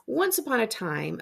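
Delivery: usual speaking voice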